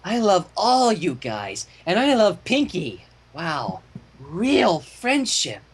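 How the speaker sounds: lightly voiced slow drunken gushing; a hint of tipsy, drawn-out affection; happy drunk. A narrator's reading. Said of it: "carrying on".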